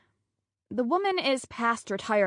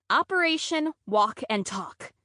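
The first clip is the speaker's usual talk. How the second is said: ordinary delivery, nothing marked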